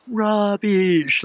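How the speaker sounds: loud, high imitated heckle; mock yell. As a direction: bark it out like an outspoken senator across the chamber. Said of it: "like this".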